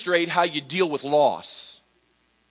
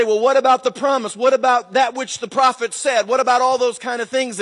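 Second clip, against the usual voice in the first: insistent questioning; 8 semitones higher, 4 dB louder than usual. Retold or imitated. Congregation voiced questioning the prophecy.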